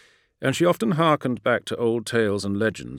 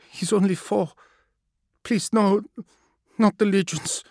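much higher, desperate plea; pleading, shaky voice; 12 semitones higher, 4 dB louder than usual. A narrator's reading. Minister begging the assassin to spare her son, high and pleading. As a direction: beg for the child's life. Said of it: "the shake in her voice".

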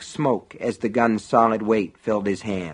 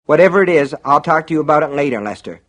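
quick, louder brush-off; hurried; 5 semitones higher, 6 dB louder, faster than usual. A narrator's reading. Deputy in haste dismissing coach, hurried. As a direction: put him off in a rush. John hurriedly puts Lester off.

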